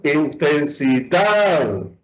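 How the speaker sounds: intensidad drawn out slowly, weight on final DAD; stress on DAD. Shown as a demonstration. Teacher showing the class where force falls, stress on final DAD of intensidad. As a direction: lean slowly on the last syllable of intensidad. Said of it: "Aquí estoy aplicando más fuerza".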